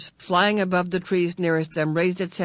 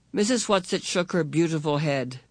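usual speaking voice

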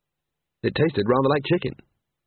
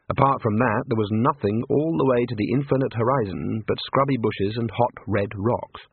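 ordinary delivery, nothing marked

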